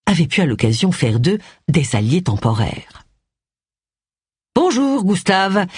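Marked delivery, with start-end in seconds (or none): 4.6-5.7 bright, slowed, cheerful greeting. A narrator's reading. Agatha greets the snobbish butler, cheerfully. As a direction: greet him with polite put-on cheer. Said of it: "d'un ton enjoué".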